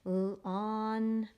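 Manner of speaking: "L-, on" with both sounds held; slow phonics segmenting; sounding out. Shown as a demonstration. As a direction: stretch "L" and "on" apart. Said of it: "I write by the syllable".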